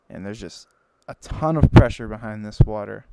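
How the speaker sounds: usual speaking voice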